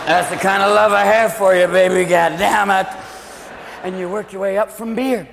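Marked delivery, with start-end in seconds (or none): 0.1-2.9 put-on drunk affection. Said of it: "friend in a headlock going".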